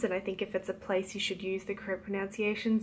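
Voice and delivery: ordinary delivery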